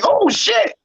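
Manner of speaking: high-pitched retold astonishment; surprised. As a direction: act out a delighted double take, pitch shooting up. Retelling his surprise on turning round.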